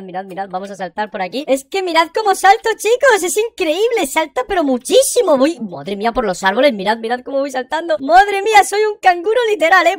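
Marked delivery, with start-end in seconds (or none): 1.5-5.4 raised, loud, thrilled voice; excited amazement. Pretend. Showing viewers the huge jumps, excited amazement. 8.0-9.9 high, loud, thrilled exclamation; excited amazement. Pretend. Bouncing like a kangaroo for viewers, excited amazement.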